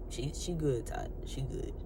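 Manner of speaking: low, soft reassurance; hushed, low, repeated reassurance; voice of the spirit. Retold or imitated. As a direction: murmur it as an inner prompting. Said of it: "the spirit is saying".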